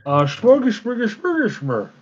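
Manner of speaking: high mock Swedish chef gibberish; Swedish chef impression. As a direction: babble like the Muppet chef. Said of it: "I wanna make a Swedish chef character now".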